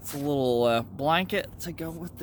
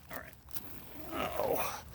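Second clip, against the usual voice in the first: whispering; 13 dB quieter, much slower than usual. Real, not acted. Crawling into tent.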